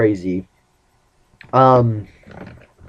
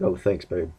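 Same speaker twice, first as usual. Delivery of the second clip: low, soft "thanks, babe"; deep flirty voice; 5 semitones lower, 9 dB quieter than usual. Retold or imitated. Complimented guy flirting back, voice gone all deep. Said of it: "My voice got all deep".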